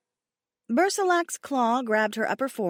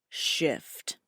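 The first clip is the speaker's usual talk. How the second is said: lower, soft, purred command; 6 semitones lower, 4 dB quieter than usual. Acted. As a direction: purr the order low into her ear. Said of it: "he ordered with a low purr".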